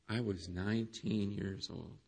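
low and very soft, almost under the breath; the pastor retells James's confession quietly, low and hushed; hushed retelling. Retold or imitated. Quiet confession.

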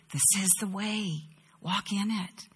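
softly voiced, quiet guidance; gentle guiding voice. Preacher voices God guiding us from behind, gently and quietly.

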